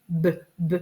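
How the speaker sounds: B as two short, separate "beu, beu" bursts; repeated letter sound. Shown as a demonstration. Teacher repeating the first sound of ballon, short and separate. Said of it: "le premier son au début".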